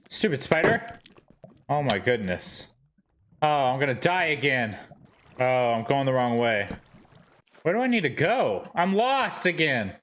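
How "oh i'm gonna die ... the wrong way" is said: ordinary delivery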